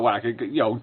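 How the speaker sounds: ordinary delivery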